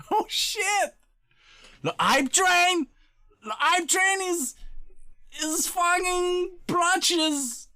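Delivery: high, slow, drawn-out excited shout; excited hype. Real, not acted. Streamer reacting to the hype train, high and slow. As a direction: stretch the words out in disbelief.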